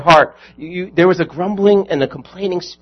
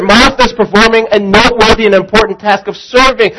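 louder, higher, driving sermon voice; full-force preaching; 6 semitones higher, 5 dB louder than usual. Acted. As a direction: press the point home to the congregation.

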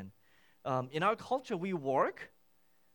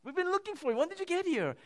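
much higher, louder, eager questioning of Jesus; eager crowd asking; 12 semitones higher, 5 dB louder, faster than usual. Acted. The crowd pressing Jesus about when he arrived, eager and high. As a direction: call out like a crowd that has been searching. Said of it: "Their question is".

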